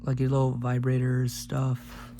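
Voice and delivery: ordinary delivery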